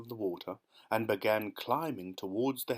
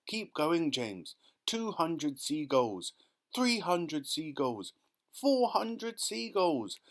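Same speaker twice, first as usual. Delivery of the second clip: slow count climbing higher and higher; building count shouts; 6 semitones higher, slower than usual. Acted. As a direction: build the excitement with each number. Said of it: "they cried".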